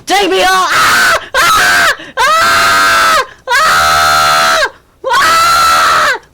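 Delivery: high, shrill hammed-up screaming; mock girly shrieks; girly screams. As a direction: scream like a panicked girl, over the top. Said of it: "that's girly screams".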